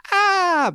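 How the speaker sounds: high, loud startled yelp; goblin's startled cry. A narrator's reading. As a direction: yelp in surprise as the wolf pounces. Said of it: "And the goblin went".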